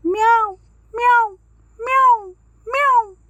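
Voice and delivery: high, loud meow, meow, meow; big cat teaching kitten to say meow, high and loud. Shown as a demonstration. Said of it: "అనాలి అని నేర్పించింది".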